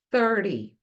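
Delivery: Thirty with a short, quick ty ending. Shown as a demonstration. Teacher contrasts thirty with thirteen for learners. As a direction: clip the ty in Thirty. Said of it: "Vamos fazer o trinta".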